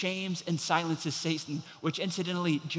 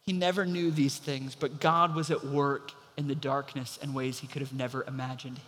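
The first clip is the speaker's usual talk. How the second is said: usual speaking voice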